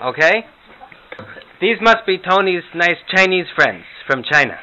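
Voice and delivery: high, chirpy grandma voice. Pretend. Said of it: "some Morty and Herta imitations".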